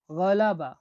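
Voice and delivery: গলাবা with a throaty g from the back of the throat, said loud; teacher sounds out গলাবা, throaty g; Uzbek word গলাবা. Shown as a demonstration. Teacher models the word গলাবা for learners, throaty g. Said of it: "দেখুন".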